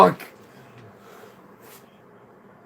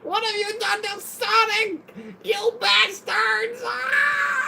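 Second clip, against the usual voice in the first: high falsetto theatrical wail; melodramatic outcry; slower than usual. Acted. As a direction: ham it up like a betrayed fan.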